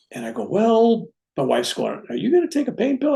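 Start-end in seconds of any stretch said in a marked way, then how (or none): 0.5-1.1 higher-pitched, hedging well; acted-out hesitation; reluctant well. Hesitant reply about the pill. 2.1-3.1 high, quick questioning wife voice; high-voiced nagging question; imitated wife. Wife questions him, acted out.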